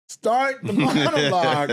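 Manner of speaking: high, slow insistent nudge; urging. Retold or imitated. Writer in the wings urges Leslie, high and insistent. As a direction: stretch it out to push her on.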